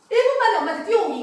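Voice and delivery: loud, high game call; rally a kid into a race; a mom shouting a fun challenge; cheerful challenge. Shown as a demonstration. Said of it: "이거를 한 열 번 시키세요".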